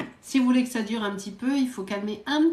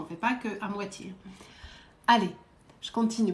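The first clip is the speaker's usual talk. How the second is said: normal talking voice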